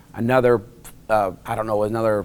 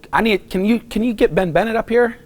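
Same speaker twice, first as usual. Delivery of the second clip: flustered, much higher plea; retold urgent request; 9 semitones higher, 3 dB louder than usual. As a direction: press for help, pitch raised.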